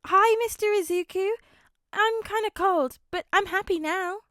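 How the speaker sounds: put-on high, loud small child's voice; a cold but happy little girl. A narrator's reading. Little girl Eri speaks.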